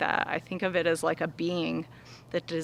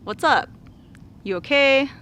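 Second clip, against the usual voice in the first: caring friend; 6 semitones higher than usual. Shown as a demonstration. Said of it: "just like you would ask a friend".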